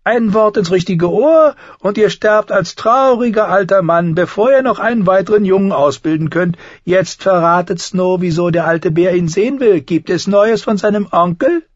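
smiling Tyrion. A narrator's reading. Tyrion answers Thorne with a smiling high voice. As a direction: smile through the retort.

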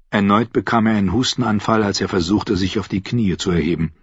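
usual speaking voice

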